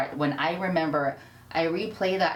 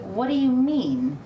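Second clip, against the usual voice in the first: slow, louder, stunned question; shocked disbelief; 7 semitones higher, 5 dB louder, slower than usual. Retold or imitated. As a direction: hear terrible news, can't take it in. Retold reaction to sister's call.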